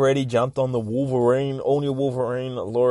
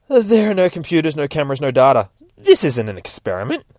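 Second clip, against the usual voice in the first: higher, louder villain boast; loud declaration; 5 semitones higher, 7 dB louder than usual. Acted. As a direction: proclaim it grandly. Doctor declares his cruel purpose.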